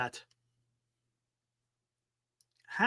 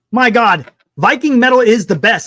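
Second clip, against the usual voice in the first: louder, gushing enthusiasm; excited outburst; 5 semitones higher, 10 dB louder than usual. Real, not acted. Streamer thrilled by Viking metal, loud excited gush. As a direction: blurt it out like a new fan. Excited about new music.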